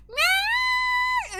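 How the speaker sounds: high, loud shouted meow; cat meow. Retold or imitated. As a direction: yowl like a demanding cat at the door. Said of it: "it's like".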